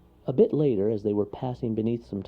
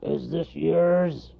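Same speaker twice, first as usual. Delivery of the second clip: growling; 9 semitones higher, 4 dB louder, much slower than usual. Acted. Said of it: "growled the bear".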